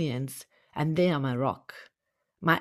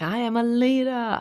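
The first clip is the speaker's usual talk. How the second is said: retold yell; 7 semitones higher than usual. Yelling back at Tony Robbins at his event, higher mock yell. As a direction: call it back like at a rally, pitch up. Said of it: "And I'm yelling back".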